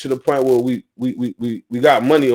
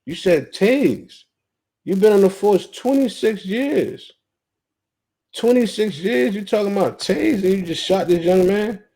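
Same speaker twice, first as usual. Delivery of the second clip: high, shocked bystanders' outburst; stunned disbelief; 6 semitones higher than usual. Retold or imitated. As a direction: play the crowd gasping in disbelief. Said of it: "Everybody was like".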